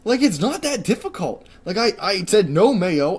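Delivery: high, loud, fed-up complaining; real frustration boiling over; exasperated rant. Real, not acted. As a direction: vent it, voice climbing high and loud. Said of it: "I'm done ranting for the night".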